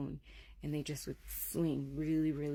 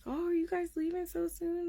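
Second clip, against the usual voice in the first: high-pitched put-on neighborly voice; nosy neighbor; 13 semitones higher than usual. Neighbors question the packing family, high and polite. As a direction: imitate polite, high small talk. Neighbours' drive-up small talk, acted.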